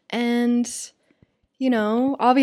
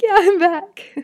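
much higher, laughing through "Yeah, I'm back"; happy laughing reply, louder; 9 semitones higher, 4 dB louder than usual. Retold or imitated. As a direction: giggle out the reply, delighted. Retelling reply to her wife.

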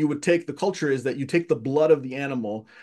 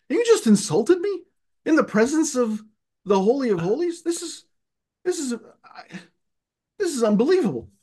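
very high-pitched, incredulous retold protest; indignant disbelief; 8 semitones higher than usual. Insulted kid's thoughts in the Holy of Holies, indignant. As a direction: act out being scandalized, faltering at the end.

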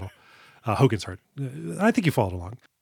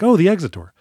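mock excited discovery; 5 semitones higher, 7 dB louder, faster than usual. Mocking horror character.

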